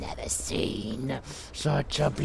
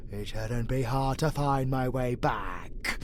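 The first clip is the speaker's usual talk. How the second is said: ordinary delivery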